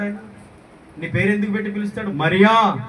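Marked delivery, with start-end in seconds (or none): 2.1-2.8 loud call of a name. Acted. Preacher as the Lord calling Mary by name, calling out.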